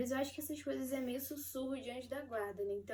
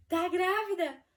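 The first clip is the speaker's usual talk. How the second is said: higher and louder, eager; husband hoping for a yes; excited question; 6 semitones higher, 11 dB louder, slower than usual. Retold or imitated. Husband Igor asks from the sofa, excited. Husband reacts to pregnancy news.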